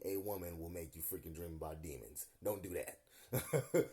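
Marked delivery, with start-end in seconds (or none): 2.4-3.8 drawn-out, deadpan warning; slow warning. Real, not acted. Warning viewers off manifesting a woman, slow and deadpan. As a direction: spell it out slowly, as a caution.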